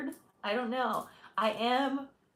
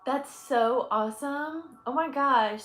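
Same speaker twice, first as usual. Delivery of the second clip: delighted; 4 semitones higher, 6 dB louder, slower than usual. Real, not acted. Reacting with delight.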